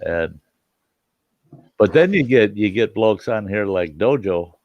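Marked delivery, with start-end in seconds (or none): none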